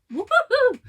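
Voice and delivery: high, loud woo-hoo cheer; excited whoop. Real, not acted. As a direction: cheer with delight. Cheering a viewer's work.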